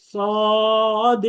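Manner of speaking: সদ said with the mouth rounded, heavy and full; round-mouthed heavy Saad. Shown as a demonstration. Teacher models Saad for the class, mouth rounded and heavy. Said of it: "মুখটাকে গোল করে".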